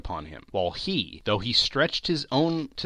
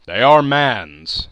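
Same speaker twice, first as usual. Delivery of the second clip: loud, slow spirit's pronouncement; grave ghostly verdict; solemn spirit; 12 dB louder, slower than usual. A narrator's reading. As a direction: declare it slowly and loudly.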